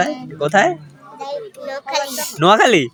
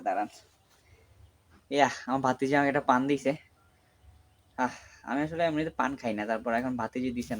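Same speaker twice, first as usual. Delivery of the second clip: worn out and quiet; 12 dB quieter than usual. Real, not acted. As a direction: talk softly, spent and tired.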